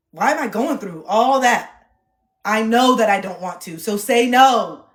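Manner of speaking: frustrated with herself, loud and slow; exasperated. Real, not acted. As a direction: scold yourself out loud.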